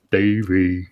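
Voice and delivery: deep goofy Davey call; lightly voiced cartoon callout; low cartoon voice. Echoing the cartoon name.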